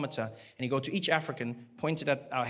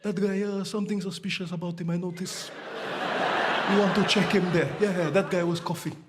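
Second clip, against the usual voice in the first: bearded passenger; 3 semitones higher than usual. Retold or imitated. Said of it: "he was like".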